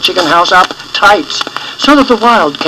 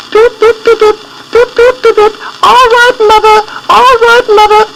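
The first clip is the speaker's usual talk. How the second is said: tiny high peeping chicks; chick voices; 4 dB louder than usual. A narrator's reading. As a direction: squeak it like little chicks. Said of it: "the little chickens were saying".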